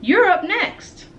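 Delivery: loud, high, slow playground call. Acted. Emilio calling Justin to his turn, loud high call. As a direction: shout it across the yard. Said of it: "Emilio calls out from the tetherball court".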